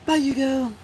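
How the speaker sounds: mocking farewell. Real, not acted. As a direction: wave off a troll in a high, loud voice. Mock farewell to a banned commenter.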